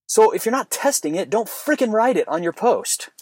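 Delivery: worked up and insistent; fired up. Real, not acted. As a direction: argue it heatedly, voice riding high. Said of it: "I'm fired up on that one".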